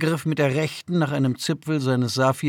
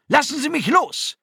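very high, indignant yell; Dalartan; 13 semitones higher, 4 dB louder than usual. A narrator's reading. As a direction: yell to be let go. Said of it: "schrie Dalartan". (hoher, wütender Schrei)

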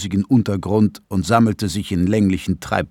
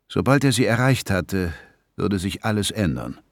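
ordinary delivery

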